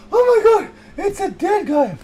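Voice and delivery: very high, loud terrified cry; hammed-up panic at a dead guy; scream of horror. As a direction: shriek at the sight of a body. Sees corpse, cries out.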